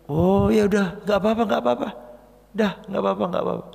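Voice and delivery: high, easygoing reassurance; forgiving car owner. Retold or imitated. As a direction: wave it off kindly. (pemilik mobil santai memaafkan, nada tinggi)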